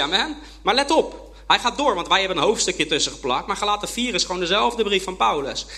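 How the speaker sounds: ordinary delivery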